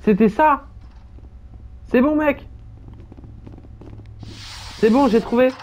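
high-pitched modded voice; voice changer. Real, not acted. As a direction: talk through a pitch-up voice filter. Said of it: "c'est normal que t'aies la voix aussi aiguë".

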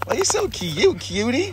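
high cooing pet voice. Real, not acted. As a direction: coo sweetly at the puppy. Baby talk to dogs.

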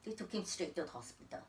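normal talking voice

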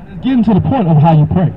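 usual speaking voice

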